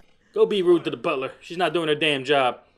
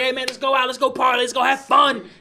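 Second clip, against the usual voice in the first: tag; 8 semitones higher, 4 dB louder than usual. Retold or imitated. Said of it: "Every time I was like".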